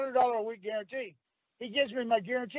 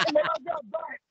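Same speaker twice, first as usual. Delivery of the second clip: laughing. Real, not acted. Laughing while telling phone bill story.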